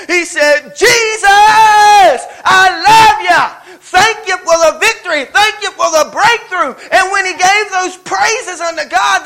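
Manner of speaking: loud, driving preacher's cadence; loud gospel preaching, voice ringing out; full-force preaching. Acted. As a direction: preach it to the rafters, building the room.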